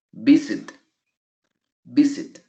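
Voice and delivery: visit with a strong VIS, light ending; stress on first syllable. Shown as a demonstration. Teacher repeats visit to show the force, first-syllable stress. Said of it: "La fuerza de voz está en la primera sílaba".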